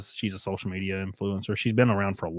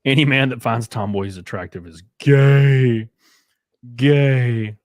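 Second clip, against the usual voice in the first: slow, louder deadpan declaration; emphatic; 7 dB louder, slower than usual. Real, not acted. Emphatic rant punchline, repeated word.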